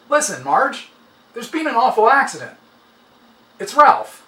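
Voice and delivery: a man calling with bad news, tense; coworker on the phone. A narrator's reading.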